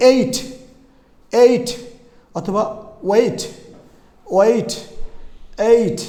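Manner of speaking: "এইট" and "ওয়েট" with a stretched glide before t; each word isolated and drawn out, falling; long ay vowel. Shown as a demonstration. Teacher drills eight and wait, long gliding vowel. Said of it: "মানে উচ্চারণ এই".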